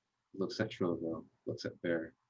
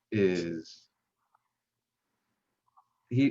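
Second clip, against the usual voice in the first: normal talking voice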